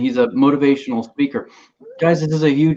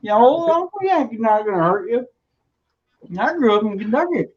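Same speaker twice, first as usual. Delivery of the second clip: Kentucky farmer voice; 7 semitones higher than usual. Pretend. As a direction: coax a goat like a shady Kentucky farmer. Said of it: "a good goat impression".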